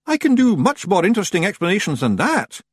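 high Scots Feagle voice; high-pitched Scottish character voice; bargaining Feagle leader. A narrator's reading. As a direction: offer it as a wee Scotsman.

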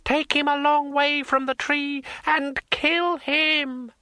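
high, slow, furious scream. A narrator's reading. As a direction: shriek the death order. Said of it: "she screamed".

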